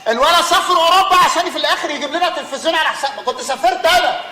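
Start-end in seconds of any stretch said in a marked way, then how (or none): 0.1-2.3 loud, high angry outburst; fuming. Acted. Father raging at the family about his son, loud angry outburst. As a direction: blow up in exasperation.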